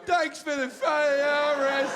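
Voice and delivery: high, squealing mock thanks; mock gratitude. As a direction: imitate absurdly delighted thanks in a high voice. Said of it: "No one's ever gone".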